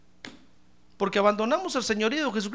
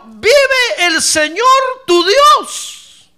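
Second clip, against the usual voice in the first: drawn-out, crying wail, much higher and louder; bitter cry; 13 semitones higher, 17 dB louder, much slower than usual. Retold or imitated. Preacher voicing the embittered widow's cry to Elijah, wailing. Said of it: "mire cómo le respondió".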